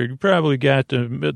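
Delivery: ordinary delivery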